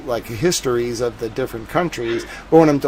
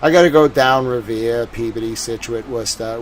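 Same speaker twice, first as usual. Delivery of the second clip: Boston accent; 4 dB louder than usual. Shown as a demonstration. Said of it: "my actual accent is probably more like".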